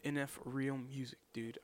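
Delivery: usual speaking voice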